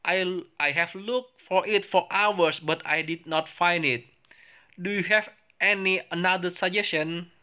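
high, slow, careful English reading voice; reading both parts for the class to copy; English dialogue read aloud. Shown as a demonstration. Reads second dialogue aloud. (membaca dialog bahasa Inggris dengan nada tinggi dan pelan)

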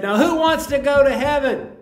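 high, loud mock preacher call to the crowd; preacher asks the congregation, hammed-up rousing call. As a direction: ham up a revival preacher asking the room. Preacher voiced in a joke.

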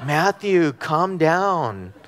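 drawn-out motherly admonition; imitating mother. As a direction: imitate mom telling her son to settle. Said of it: "I hear her voice".

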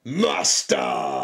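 long, drawn-out mock announcer 'Master'. Friend cued to finish the Master System slogan, low drawn-out delivery. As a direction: stretch 'Master' out low and grand. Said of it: "gotta say master here in the way you usually".

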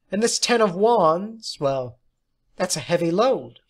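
lightly voiced tarot prophecy; ominous fortune teller. A narrator's reading. Tarot reading for Wayne.

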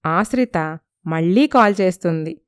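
usual speaking voice

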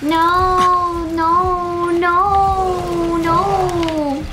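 high, rapid noes stretching into a wail; high drawn-out cries of dread; panicked. Real, not acted. Fleeing the enemy.

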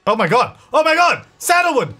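high, loud startled cries; shocked, high-pitched outburst; alarmed shout. Real, not acted. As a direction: yell the name in escalating alarm. Alarmed at cat's sudden outburst.

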